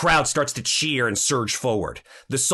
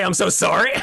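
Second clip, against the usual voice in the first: laughing mock apology; 9 semitones higher, 7 dB louder, faster than usual. Real, not acted. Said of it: "laughing at the apology".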